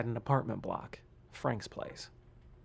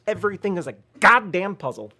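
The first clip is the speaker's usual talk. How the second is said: louder, exasperated complaint; frustrated outburst; 6 semitones higher, 11 dB louder than usual. Real, not acted. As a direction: vent at the game. Frustrated at puzzle game.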